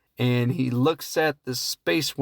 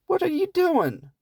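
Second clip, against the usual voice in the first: mock incredulous squeak. Mocking her loud headphones.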